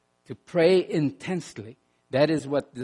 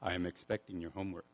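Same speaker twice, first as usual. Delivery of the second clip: much lower, softer, fast professor voice; a hint of a strict teacher lowering his voice; deeper stern professor; 9 semitones lower, 16 dB quieter, much faster than usual. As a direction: play the stern professor with a deep low voice. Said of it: "in a deeper, lower voice".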